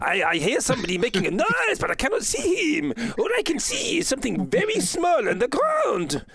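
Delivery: high, haughty elf voice; mocking elf. Elf mocking the tiny dwarf on the ground, high sneer. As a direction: look down your nose and sneer upward in pitch.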